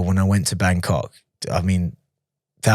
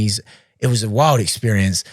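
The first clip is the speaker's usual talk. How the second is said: ordinary talk, nothing marked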